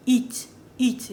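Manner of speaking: "Eat" for heat, H left silent; French heat without H. Shown as a demonstration. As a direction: drop the H, say "Eat". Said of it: "it means eat".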